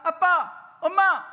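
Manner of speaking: put-on loud, high childlike call to mom and dad; child calling out. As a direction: call out like a small child wanting something. Child calling parents in sermon.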